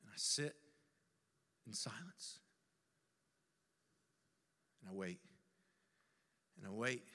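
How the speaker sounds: very low and soft, nearly whispered; hushed waiting. Acted. Lost man sitting alone and waiting, hushed. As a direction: let it sink into silence.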